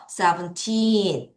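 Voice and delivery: put-on Seventeen with a long, strong TEEN; seven-TEEEN, ending held; stress on TEEN. Shown as a demonstration. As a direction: stretch the last syllable of Seventeen. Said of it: "틴을 강하게 읽겠습니다".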